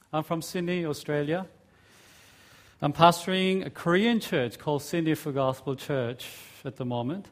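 ordinary talk, nothing marked

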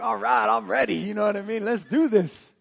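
loud, punchy self pep talk; rally yourself as if charging in; psyched-up readiness. Retold or imitated. Psyching himself up for jail.

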